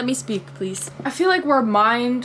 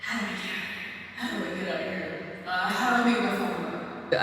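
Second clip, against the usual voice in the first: barely voiced, hushed inner panic; quiet, breathy worry under the breath; 8 dB quieter, faster than usual. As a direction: mutter it to yourself, trapped and lost.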